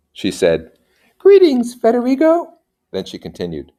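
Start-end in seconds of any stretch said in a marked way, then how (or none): 1.2-2.5 bright, loud high-voiced noblewoman; high lady greeting. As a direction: greet him grandly as a lady.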